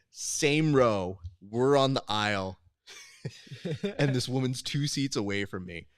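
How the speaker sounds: ordinary delivery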